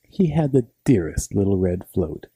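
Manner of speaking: usual speaking voice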